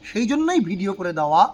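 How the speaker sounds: ordinary delivery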